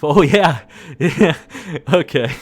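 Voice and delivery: words broken up by laughter; can't stop laughing while talking. Real, not acted. Host finding the comment stupid, laughing through the words. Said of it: "I can't stop laughing".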